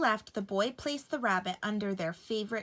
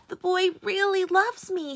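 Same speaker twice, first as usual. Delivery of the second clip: higher, louder happy rabbit; beaming with joy; joyful thought; 10 semitones higher, 7 dB louder than usual. A narrator's reading. Rabbit delighted that the boy loves him, high and loud. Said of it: "he thought".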